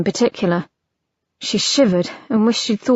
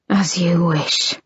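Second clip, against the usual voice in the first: whisper; 4 semitones lower than usual. A narrator's reading. Harry obeying her, hushed.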